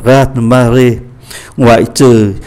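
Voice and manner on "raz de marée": raz-de-marée run together quickly; fluent quick French raz-de-marée; fast reading. Shown as a demonstration. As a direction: say raz-de-marée at natural speed, syllables blended. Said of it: "đọc nhanh là".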